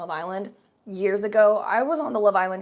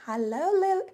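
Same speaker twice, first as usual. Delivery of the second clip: high, lilting Hello, Lily; playful high-pitched Hello, Lily; sing-song hello; 10 semitones higher than usual. Acted. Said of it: "I mean, hi-lo".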